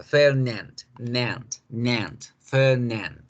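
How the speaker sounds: Nant with the open English "butterfly" a. Shown as a demonstration. Teacher isolates the second syllable, English open a in Nant. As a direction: open the a wide in Fernand and Nant. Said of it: "vamos a utilizar la A de mariposa".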